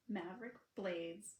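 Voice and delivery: plain reading voice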